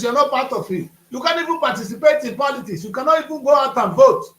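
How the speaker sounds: ordinary talk, nothing marked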